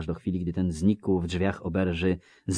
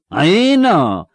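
loud, higher, slurring tipsy villager; drunk Melfi; 12 semitones higher, 14 dB louder, much slower than usual. Acted. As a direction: call out like a cheery drunk. Melfi agrees. (pijany Melfi, głośno i bełkotliwie)

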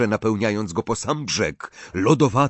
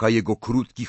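ordinary delivery, nothing marked

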